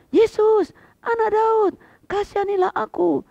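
very high, loud piercing shout; preacher acting the beggar's loud, very high plea; shrill cry. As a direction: cry out with your whole heart. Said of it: "Tapi dia teriak".

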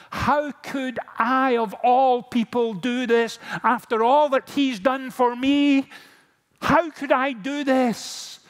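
high, loud, slow anguished cry; Peter's loud, high lament over his betrayal, lying awake; anguished self-reproach. Acted. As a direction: cry it out as a man who betrayed his friend. Peter weeping in bed, self-reproach.

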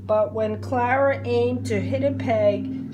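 ordinary delivery

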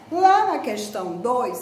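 usual speaking voice

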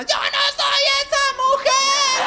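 very high, slow, drawn-out wail; drunk dramatic declaration. Retold or imitated. Newly separated woman at the disco, slow, very high dramatic wail. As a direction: stretch it out like a drunk diva.

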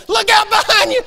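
high, loud, frantic mock warning; acted panicked holler; hollering at the screen. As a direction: yell like an over-invested movie watcher. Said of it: "I'll be hollering at the guy".

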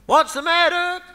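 high, loud call back from downstairs; shouted reply. Retold or imitated. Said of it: "he shouted back".

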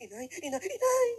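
mock a poor child calling mother, rising to a cry; poor kid's pleading call, sharply rising; poor person's whine. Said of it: "pailalim eh".

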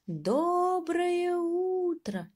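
very slow, high, drawn-out call; calling with all his might. Acted. Mouse straining to reach the bird, high and long. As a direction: call out as hard as a tiny mouse can. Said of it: "изо всех сил крикнул мышонок".

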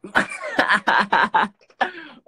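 high, loud burst of laughter; real laughing fit. Real, not acted. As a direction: crack up hard at the troll. Laughing at being trolled.

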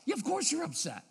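high, light, assured validation; confident validation. Acted. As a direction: affirm the child's upset with easy confidence. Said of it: "with confidence of like".

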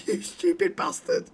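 slow, wheezy words through laughter; laughing. Real, not acted. Player mocks the departed patient, laughing. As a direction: laugh helplessly through the insult.

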